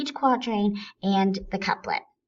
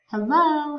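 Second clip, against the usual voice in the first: helLO, higher and stronger on LO; stress on second syllable; 6 semitones higher, 4 dB louder than usual. Shown as a demonstration. Teacher showing hello is iambic, stress on LO. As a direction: lean on the LO of hello. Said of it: "We stress the word, the second part".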